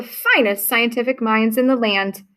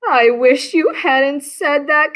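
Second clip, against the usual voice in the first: groaned; 5 semitones higher, slower than usual. Acted. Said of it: "Camilla groaned".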